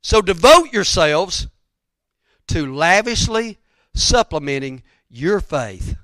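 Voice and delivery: loud, slow preacher's declamation; forceful exhortation, every word stretched; emphatic scripture quote. Acted. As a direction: proclaim it in weighty, separated phrases.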